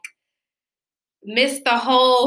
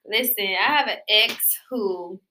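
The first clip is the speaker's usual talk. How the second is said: ordinary talk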